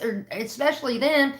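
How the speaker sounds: normal talking voice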